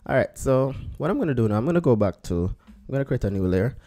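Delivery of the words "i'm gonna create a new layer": normal talking voice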